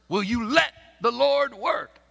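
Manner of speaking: ordinary delivery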